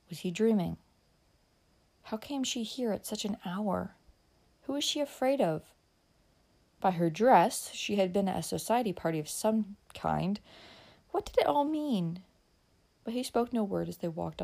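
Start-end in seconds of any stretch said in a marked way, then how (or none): none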